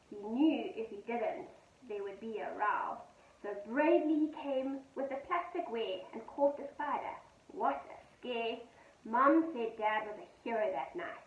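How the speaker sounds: gentle sing-song poem reading; poem read to children. A narrator's reading. Teacher reading a poem to her pupils, gentle and sing-song.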